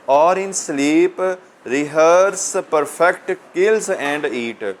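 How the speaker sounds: poem recitation. Real, not acted. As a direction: recite it slowly, word by word. Reads poem line aloud to students.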